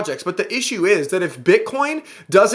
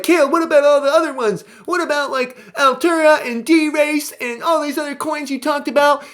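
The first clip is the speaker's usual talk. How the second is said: higher, whiny imitation of a complaining viewer; mock whiny viewer; 7 semitones higher than usual. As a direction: mimic a nagging fan, lightly. Mocking viewers' questions.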